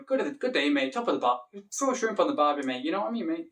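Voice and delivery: matey Australian accent; playful stock-phrase Australian. Shown as a demonstration. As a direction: put on a casual Aussie accent. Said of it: "Australian accent".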